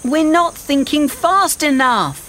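put-on high boy's cry of urgency; urgent, high-pitched alarm; worried cry. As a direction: cry out as time runs short. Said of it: "Ben cried".

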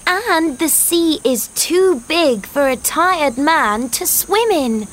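put-on very high girl's voice explaining; eager, high-pitched reasoning; excited cry. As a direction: announce the solution in triumph. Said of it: "she cried".

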